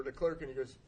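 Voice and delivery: ordinary talk, nothing marked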